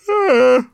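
loud, high-pitched yawn. A narrator's reading. Sorrel answers the dragon with a yawn, loud yawn. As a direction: let out a big vocal yawn. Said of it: "Sorrel yawned".

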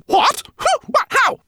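high, frightened stammering; startled stammer. Acted. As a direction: sputter in fright. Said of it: "she stammered".

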